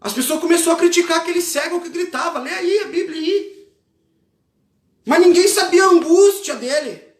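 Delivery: high, impassioned sermon shout; loud, driving revival preacher delivery; full-force preaching. Real, not acted. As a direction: preach urgently at the top of your register.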